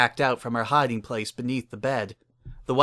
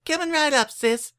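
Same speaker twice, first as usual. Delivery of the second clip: put-on much higher, bright little-girl voice; cheerful little filly; 3 dB louder than usual. Little filly answering her big sister, eager and high. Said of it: "said with a smile".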